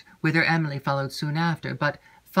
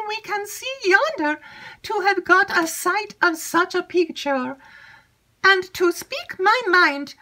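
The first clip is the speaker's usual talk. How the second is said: put-on much higher, eager young maid's voice; chattering maid Annette. Maid Annette eagerly seeking Emily, high excited chatter. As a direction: gush it out high and breathless with news.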